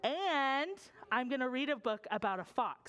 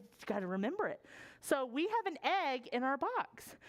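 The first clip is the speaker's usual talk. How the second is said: ordinary delivery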